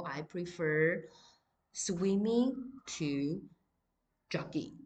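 slow, deliberate dictation pace; slow classroom example sentence; slow example read. Shown as a demonstration. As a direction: read it out slowly for students to copy. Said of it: "so for example".

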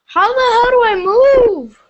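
loud, high, exasperated shout at the game; frustrated yell. Real, not acted. Gamer stuck in the game, yelling in frustration. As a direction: yell it out, fed up with the controls.

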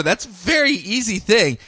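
ordinary talk

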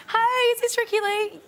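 high, excitable phone caller; imitated fan caller. Imitates strangers ringing her leaked number.

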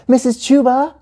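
high-pitched call to teacher; high, rising call. A narrator's reading. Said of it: "Gia called".

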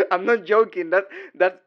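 ordinary talk

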